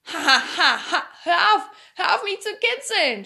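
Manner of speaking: high, loud cry through acted laughter; laughing shout. A narrator's reading. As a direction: shriek as if being tickled. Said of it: "schrie Pipi".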